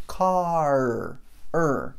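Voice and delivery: "Car" with a long final R, then the R alone as "er"; drawn-out English R on "Car" and "er"; super strong R. Shown as a demonstration. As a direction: hold the ending of "Car" and repeat it as "er". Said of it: "super forte".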